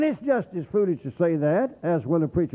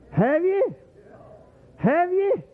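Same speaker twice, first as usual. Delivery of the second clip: high, insistent have you; pressing question; 12 semitones higher, slower than usual. Real, not acted. Preacher challenging the congregation, high and insistent. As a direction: push the question hard at them. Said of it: "repeated challenge to congregation".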